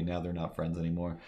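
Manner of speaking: normal talking voice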